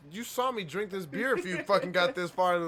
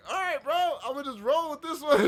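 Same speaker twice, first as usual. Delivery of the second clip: laughing along; 10 semitones higher, 5 dB louder, faster than usual. Retold or imitated. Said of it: "you're just like".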